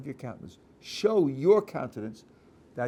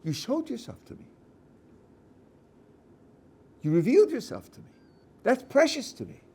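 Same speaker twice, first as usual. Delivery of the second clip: higher, leaning on "showed", "revealed" and "precious"; emphasis on revelation; 11 semitones higher, 4 dB louder than usual. Shown as a demonstration. Rabbi demonstrating the other reading, stressing "revealed". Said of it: "Or is the emphasis on".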